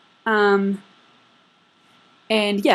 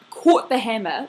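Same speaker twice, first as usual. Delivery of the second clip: ordinary talk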